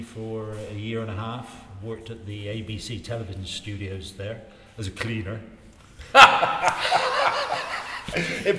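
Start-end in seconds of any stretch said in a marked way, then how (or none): 5.5-8.4 loud burst of real laughter; big laugh. Real, not acted. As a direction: laugh out loud, high and free. Laughs about cleaner job.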